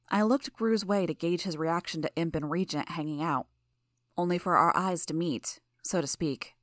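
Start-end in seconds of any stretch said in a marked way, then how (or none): none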